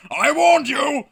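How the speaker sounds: high, loud, wrathful proclamation; God, terrifying and booming. Acted. God orders the mutiny celebration to cease, booming. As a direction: thunder down at the sinners. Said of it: "says God".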